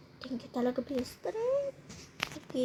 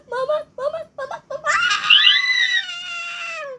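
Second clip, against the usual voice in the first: terrified screaming; 5 dB louder, slower than usual. Acted. Screaming in fright on ride.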